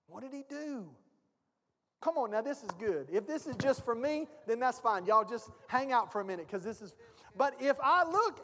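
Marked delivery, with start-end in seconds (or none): none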